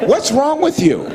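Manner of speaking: high-pitched imitated guard outburst; mock the guard's indignant cry; exasperated outburst. Guard faced with a disobedient inmate, acted exasperation.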